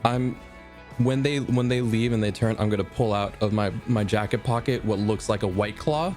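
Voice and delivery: ordinary talk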